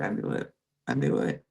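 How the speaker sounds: soft, low "I knew it", half whispered; knowing whisper. Retold or imitated. Ferran quietly confirming his suspicion, hushed. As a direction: mutter it like you called it all along. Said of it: "And Ferran's like".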